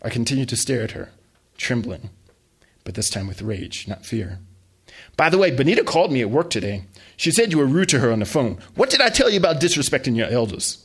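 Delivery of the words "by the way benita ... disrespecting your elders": loud, reproachful scold; mother scolding her son for rudeness; scolding mom. Acted. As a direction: chew him out.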